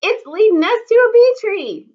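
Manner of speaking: high, loud wail while running. Acted. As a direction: wail it as you streak past. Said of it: "Mary Ellen wailed".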